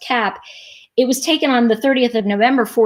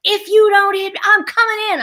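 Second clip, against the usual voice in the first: high-pitched mock angry warning; mad father; 10 semitones higher, 3 dB louder than usual. Imitating dad threatening to come into the room, mock angry. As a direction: imitate an angry parent's raised voice. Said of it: "he was mad at me".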